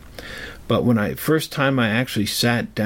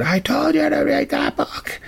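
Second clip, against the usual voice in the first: much higher, mock screamed rebuke; retold scream. The man coming back to the boy in the library, imitated angry scream. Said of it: "he screamed at me".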